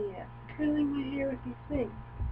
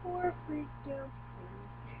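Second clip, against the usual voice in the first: drawn out, much softer pity; slow soft pity; 4 semitones higher, 9 dB quieter, much slower than usual. Real, not acted. Owner pities her scared dog, slow and soft. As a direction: coo it slowly and softly.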